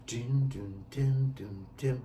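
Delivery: hummed piano notes with the strum; sung piano beat. Shown as a demonstration. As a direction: imitate a piano chord pulse. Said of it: "we wanna emulate the piano".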